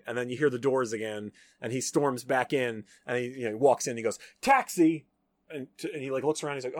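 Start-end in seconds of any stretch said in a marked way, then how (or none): 4.4-5.0 loud, raised hail for a cab; calling out. Retold or imitated. Man thinks he's outside hailing a cab, calling out. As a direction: imitate someone shouting for a taxi.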